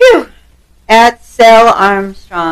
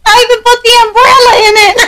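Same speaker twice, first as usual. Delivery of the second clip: high, fast Jersey waitress imitation; laughing mock waitress voice; 11 semitones higher, faster than usual. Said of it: "imitating the waitress".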